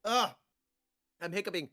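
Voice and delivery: usual speaking voice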